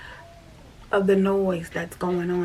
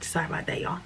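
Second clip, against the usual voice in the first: usual speaking voice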